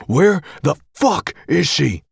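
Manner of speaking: furious yell. Acted. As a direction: hammer each word in rage. Brother furious on the phone.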